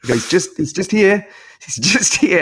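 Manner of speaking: ordinary talk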